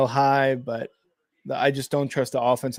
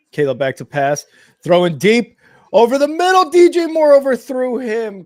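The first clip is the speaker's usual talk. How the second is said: rising excited call, higher and louder; live play-by-play; 10 semitones higher, 8 dB louder than usual. Real, not acted. Streamer calling the Bears pass live to viewers. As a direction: call the throw as it happens.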